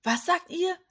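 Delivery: high, loud, astonished question; agitated cry. A narrator's reading. Jakob reacting to his sons' news, agitated cry. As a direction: call out in sudden agitation. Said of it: "rief Jakob erregt".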